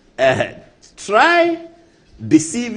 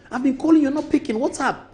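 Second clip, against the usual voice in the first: hammed-up pushy colleague urging; high pitched mock nagging about the bill; imitating Brother Fo; 10 semitones higher than usual. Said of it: "And that's how he talks".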